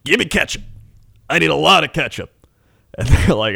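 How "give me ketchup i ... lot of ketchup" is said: put-on old trucker. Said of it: "he's like".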